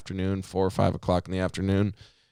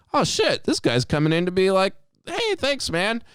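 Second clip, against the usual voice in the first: very high, eager expectation; excited anticipation; 10 semitones higher than usual. Retold or imitated. Worker expecting thanks from the rescued trucker. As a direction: gush as if he's coming to thank you. Excited retelling seeing him.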